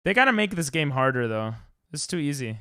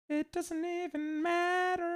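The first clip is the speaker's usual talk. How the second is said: high, slow sing-song; dismissive high drawl; 14 semitones higher, 7 dB quieter, slower than usual. Real, not acted. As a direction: stretch it out lazily, high and soft. Drawn-out sing-song aside.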